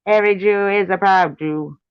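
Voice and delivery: high put-on Russian accent; sing-song proud chant in Russian accent. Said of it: "talk in that Russian accent".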